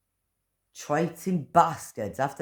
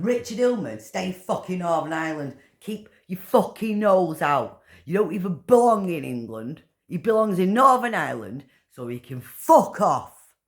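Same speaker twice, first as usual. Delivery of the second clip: furious shouting; 5 semitones higher, 4 dB louder than usual. Real, not acted. Angry rant at public figures.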